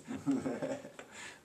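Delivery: quiet self-amused laugh; real soft chuckle. Real, not acted. Reader flubs a line, soft real chuckle. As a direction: chuckle softly at your own mistake.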